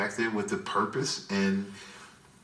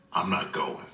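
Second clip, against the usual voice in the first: lowered voice, playing the man; trying to sound deep; 8 semitones lower than usual. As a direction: drop your voice low to stand up to dad. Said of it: "Tried to get a little deep on my voice".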